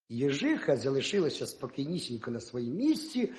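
plain reading voice

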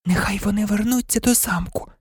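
high, softened planning voice; still shaken. A narrator's reading. Hermione planning the flight to Sirius, still shaken. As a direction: lay out the plan in a thin, high hush. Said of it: "не тямилася від пережитого".

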